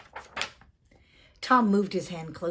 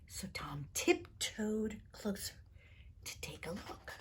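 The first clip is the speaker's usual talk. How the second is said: whisper; 12 dB quieter than usual. A narrator's reading. Tom sneaking closer.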